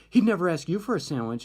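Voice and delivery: high, loud mock retort; retold anger. Angry student disputing with her friend, retold retort. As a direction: argue back as the angry colleague. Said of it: "she was kinda angry".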